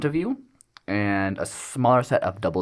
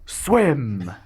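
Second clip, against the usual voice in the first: booming voice; 5 semitones higher than usual. Acted. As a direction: boom it out like a magical order. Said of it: "this voice just booms".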